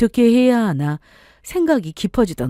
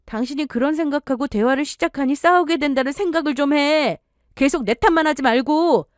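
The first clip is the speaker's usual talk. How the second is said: higher, reproachful wife; wife's retort; 7 semitones higher than usual. Acted. Wife telling husband to stop blaming her, higher and reproachful. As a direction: tell him to stop blaming you.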